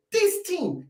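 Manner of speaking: "This team" pitched high and insistent; both words "This team" held up high for emphasis; stress on THIS TEAM. Real, not acted. Fan arguing Emery reached a final, "This team" stressed high.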